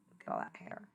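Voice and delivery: breathy aside about the hair; soft whispered aside. Acted. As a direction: murmur it under your breath.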